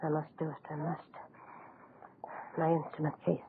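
barely audible, breath held as he works; hushed and tense. Acted. Doctor steeling himself over the dying woman, hushed. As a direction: murmur each step to steady trembling hands.

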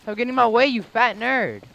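loud, annoyed gamer yell; angry yell. Real, not acted. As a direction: shout it at the other player. Yelling at another player.

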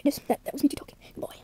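soft unvoiced aside; whispering. Real, not acted. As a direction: whisper it to the audience. Aside about the toy voice.

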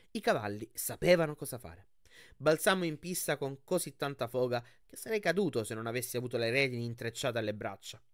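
ordinary delivery

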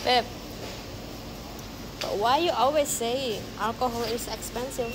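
usual speaking voice

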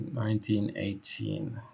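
normal talking voice

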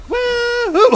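high, loud cheer; excited whoop. Real, not acted. Player cheering a hit on the boss, loud high whoop. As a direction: whoop at a big win.

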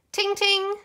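high, loud "ting, ting" of a bus bell; bright-pitched imitation bell. A narrator's reading. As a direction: ring it out like a bell.

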